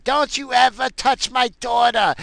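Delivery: high-pitched mock yell; retold scream. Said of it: "you hear someone screaming".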